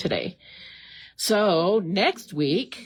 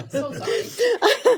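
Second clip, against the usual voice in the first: laughing apology; 10 semitones higher, 4 dB louder, much slower than usual. Real, not acted.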